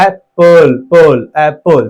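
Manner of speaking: एप्पल split into "ap" and "pol"; syllable by syllable. Shown as a demonstration. Teacher showing two vowels in apple, syllable by syllable. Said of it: "ए आया, ए और प में अ".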